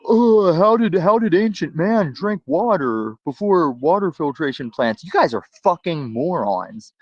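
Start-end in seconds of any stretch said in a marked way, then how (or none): 0.1-5.0 mock-dumb panelist voice, lightly done. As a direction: ham up a clueless question.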